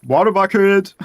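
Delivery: shout. Real, not acted. Panicked call mid build.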